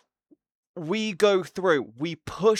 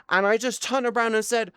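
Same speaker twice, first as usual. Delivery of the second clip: ordinary delivery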